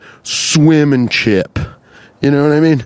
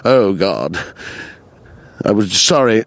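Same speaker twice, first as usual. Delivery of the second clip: normal talking voice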